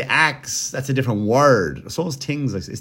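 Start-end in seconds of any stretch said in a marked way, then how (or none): 0.0-0.7 "axe" with a clear ks ending; ax for ask. Shown as a demonstration. Host argues it is another word, "axe" with ks. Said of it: "when you say". 2.2-2.5 "tings" with a T in place of TH; tings for things. Shown as a demonstration. Host asks if it is a word, "tings" with T. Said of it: "if I say".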